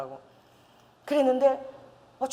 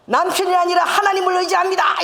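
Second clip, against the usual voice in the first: loud, high declaration repeated by rote; 크게 복창하듯; loud recitation; 8 semitones higher, 5 dB louder than usual. Retold or imitated. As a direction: shout the vow like a soldier's drill. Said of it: "이거 복창을 열 번 하래".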